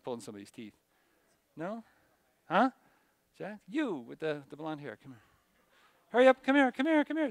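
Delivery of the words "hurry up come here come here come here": higher and louder, hurrying a student; calling out. Real, not acted. Teacher calling blonde student forward, loud. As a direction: hurry him up to the front.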